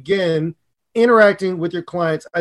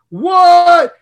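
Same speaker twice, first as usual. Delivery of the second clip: high, loud, stretched What?; mock shock; 10 semitones higher, 7 dB louder than usual. Host acting out a Chicago listener's outrage, loud shocked what. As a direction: gasp out the word in disbelief. Reacting to the anti-Chicago pizza line.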